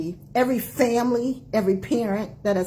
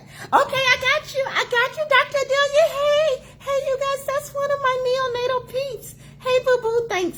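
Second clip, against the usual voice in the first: bright high-pitched hello to viewers; gushing shout-out to a joining colleague; high excited greeting. Real, not acted. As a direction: squeal a welcome to a friend.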